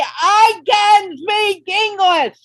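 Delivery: furious, slow, emphatic declaration; mad shout. Shown as a demonstration. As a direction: yell it at the listener like you mean it. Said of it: "Get mad at me and say".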